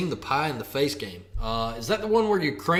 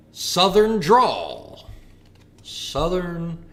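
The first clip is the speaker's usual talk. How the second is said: drawn out announcement; 4 semitones higher, 4 dB louder, much slower than usual. Shown as a demonstration. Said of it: "And our first one is".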